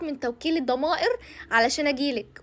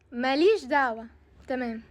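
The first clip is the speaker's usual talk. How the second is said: ordinary talk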